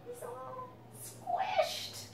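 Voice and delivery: high, slow and squeezed-sounding; squished sympathy. A narrator's reading. Parent pitying the squished pigeon for the kids, high and slow. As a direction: stretch it out in pity.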